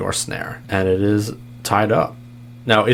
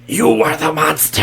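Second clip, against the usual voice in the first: high, louder monster voice; creature voice; 8 semitones higher, 4 dB louder than usual. Acted. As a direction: snarl it as the river creature.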